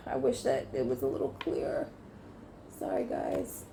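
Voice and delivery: low, voiceless murmur; hushed aside; whispered aside. Real, not acted. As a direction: whisper it as a sideline apology. Mumbled aside while shuffling.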